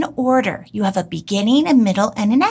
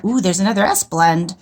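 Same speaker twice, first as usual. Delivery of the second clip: ordinary delivery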